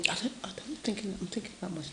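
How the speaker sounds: hushed mutter off mic; whisper. Real, not acted. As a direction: whisper to yourself while thinking. Aside while thinking of story.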